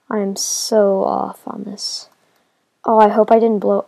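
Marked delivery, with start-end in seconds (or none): 2.8-3.9 sudden louder worried cry; alarmed outburst. Real, not acted. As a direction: blurt it out in alarm. Creeper explosion panic.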